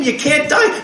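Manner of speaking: usual speaking voice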